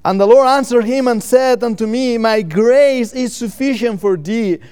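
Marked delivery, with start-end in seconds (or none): none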